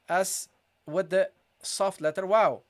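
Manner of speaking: usual speaking voice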